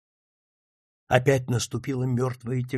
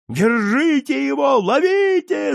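very high, urgent yells; frantic loud hue and cry; crowd shouting; 11 semitones higher, 5 dB louder than usual. A narrator's reading. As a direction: scream after a fleeing thief. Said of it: "закричали кругом".

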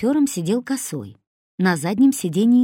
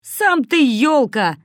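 slow, louder, much higher angry snap; angry retort; 8 semitones higher, 7 dB louder, much slower than usual. A narrator's reading. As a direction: throw the insult back slowly. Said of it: "разозлился Косой". (медленно, зло и громко)

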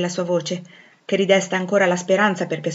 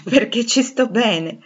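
bitter laugh; 4 semitones higher, 4 dB louder, slower than usual. Acted.

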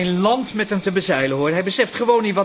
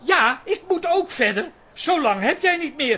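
defiant retort; 6 semitones higher, 4 dB louder than usual. Acted. Son provokes father.

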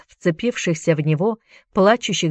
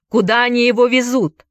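higher-pitched urgent cry; 7 semitones higher, 4 dB louder than usual. A narrator's reading. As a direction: call out the question, voice raised. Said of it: "крикнул Энди".

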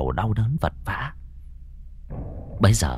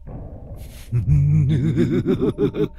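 eerie ghost cackle; high ghostly cackle; cackling laugh; 6 semitones higher than usual. Acted. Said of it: "rồi cười khanh khách".

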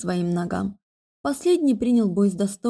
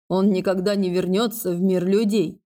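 usual speaking voice

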